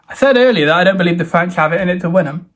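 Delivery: normal talking voice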